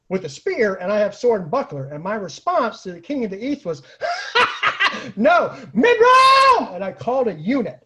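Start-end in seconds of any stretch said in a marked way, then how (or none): 4.0-5.1 high burst of real laughter; big laugh. Real, not acted. Laughing at the king's challenge. 5.2-6.7 high, slow shout through laughter; laughing shout. Retold or imitated. Retold reply to the king.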